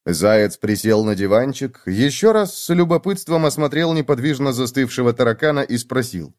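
ordinary delivery

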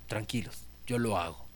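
Jesus calmly reassuring his worried disciples; calm reassurance. Acted. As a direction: gently settle their worries.

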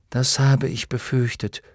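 plain reading voice

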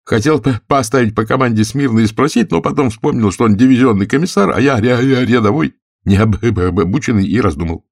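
high-pitched stutterer telling his story; stuttering Gursky. Acted. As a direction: trip on the first consonants and press on.

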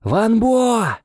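loud, high call; calling again. A narrator's reading. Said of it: "снова позвал я".

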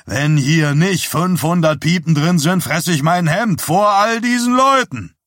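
loud, climbing to a high shout; blustering challenge. A narrator's reading. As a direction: boast it angrily before the crowd. Bernard loudly challenges the showman. (lautstark polternd)

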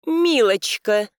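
slow, poisonously sweet; venomous sweetness. A narrator's reading. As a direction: purr it like a snake. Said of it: "леди улыбнулась, как ... ядовитая змея".

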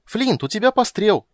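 high, loud, thrilled cry; delighted shout. A narrator's reading. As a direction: call it out in sheer delight. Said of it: "восторженно закричал Тасс". (восторженный крик)

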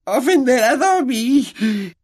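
a house-elf shocked at the very idea; displeased elf. A narrator's reading. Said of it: "con voz disgustada".

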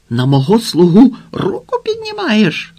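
high, loud snarl of outrage; indignant roar at an underling; angry bark. A narrator's reading. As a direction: bark it out, pitch climbing hard. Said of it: "гарикнув він".

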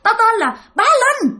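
high, shrill bragging yell; yelling ghost. Retold or imitated. Possessed woman's ghost announces its name, high shrill yell. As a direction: shout your name like a boast. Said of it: "Mà la làng thấy ghê lắm".

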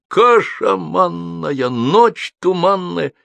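high, very drawn-out declamatory yell; bellowing. A narrator's reading. Joker performs his rhyme to the whole street, bellowing. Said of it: "ру почти не своим голосом".

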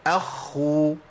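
اخو with the خ held long before the u; خاء مشددة مضمومة; doubled kha, damma. Shown as a demonstration. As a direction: hold the خ in اخو then release. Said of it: "مع المشدد بضم".

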